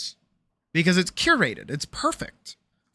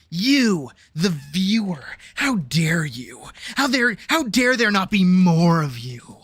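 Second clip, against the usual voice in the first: slow, indignant mock streamer voice; bitter streamer; slower than usual. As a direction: drawl out the outrage like an offended small streamer.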